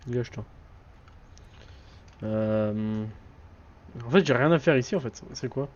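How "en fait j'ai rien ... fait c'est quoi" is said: ordinary delivery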